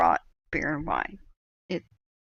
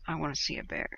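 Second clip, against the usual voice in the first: smiling quiet wish; 2 semitones lower, 8 dB quieter, faster than usual. Retold or imitated. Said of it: "he smiles and he goes".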